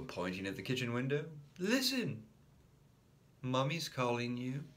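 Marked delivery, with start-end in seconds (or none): 1.6-4.6 high urgent listen; sly brother. A narrator's reading. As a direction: trick him away. Brother tricking him.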